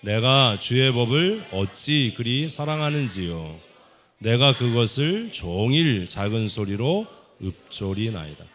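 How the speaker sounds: 느리고 크게 선창하는 낭독; 또박또박 크게 읽어 따라 하게; 큰 소리로 낭독. Shown as a demonstration. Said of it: "아이, 크게". (큰 소리로 천천히 성경 낭독)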